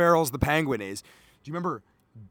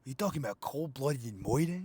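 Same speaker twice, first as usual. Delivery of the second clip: low-key fake gangster accent, merged Italian and Chicago; muttered questioning in a mock Italian-Chicago accent; Colin Farrell's Penguin; 4 semitones lower, 9 dB quieter than usual. Mocking the Penguin's accent in a film review, fake Italian-Chicago impression. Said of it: "this is Colin Farrell in the Penguin".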